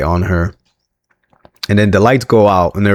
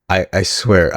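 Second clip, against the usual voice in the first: ordinary talk, nothing marked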